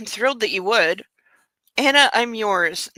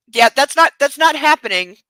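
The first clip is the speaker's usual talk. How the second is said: emphatic refusal; 4 semitones higher, 6 dB louder than usual. Real, not acted. Refusing loudly.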